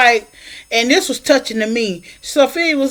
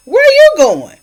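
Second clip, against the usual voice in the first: high, loud, startled call; alarmed shout; 9 semitones higher, 7 dB louder than usual. Retold or imitated. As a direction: yell after her as she walks out. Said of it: "Harpo was like".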